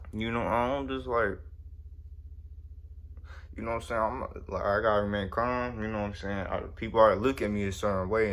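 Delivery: usual speaking voice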